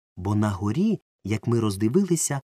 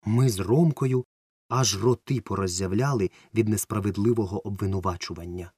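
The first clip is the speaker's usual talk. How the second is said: usual speaking voice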